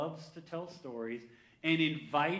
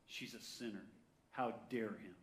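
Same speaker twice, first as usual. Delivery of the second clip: hushed, low mutter of disapproval; judgemental inner thought; 6 semitones lower, 12 dB quieter than usual. Acted. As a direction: say it low, as if to yourself. Voices the Pharisee's inner thought.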